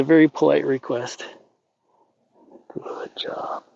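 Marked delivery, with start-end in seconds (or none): 2.7-3.6 hushed whisper of praise. Real, not acted. Trainer whispering praise to the calm mare. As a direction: breathe the praise to her.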